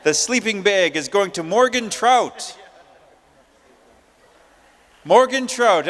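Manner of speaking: ordinary delivery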